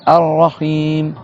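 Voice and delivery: Ar-Rahim, slow, held R and long i; prolonged i. Shown as a demonstration. Teacher modelling for students, Ar-Rahim slow with long i. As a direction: draw out the i of Ar-Rahim. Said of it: "avec le i prolongé".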